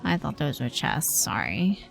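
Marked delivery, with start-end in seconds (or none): none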